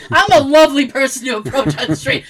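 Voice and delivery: usual speaking voice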